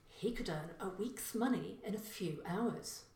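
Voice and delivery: ordinary delivery, nothing marked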